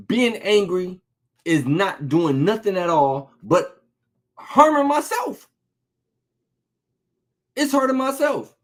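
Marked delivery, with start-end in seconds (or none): 4.4-8.5 much higher preaching cry; rising preacher fervor; pitch rising. Real, not acted. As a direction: lift it up high, pressing the point.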